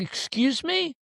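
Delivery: high, affronted question; mock-indignant high query; offended disbelief. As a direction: shoot up in pitch, insulted. Second offended reply, retold.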